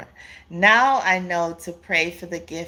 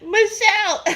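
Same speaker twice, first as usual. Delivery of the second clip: calling the name through laughter, much higher; laughing; 13 semitones higher than usual. Real, not acted. Laughing at Michelle's comment about the ex. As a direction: squeal the name mid-laugh. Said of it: "don't make me laugh".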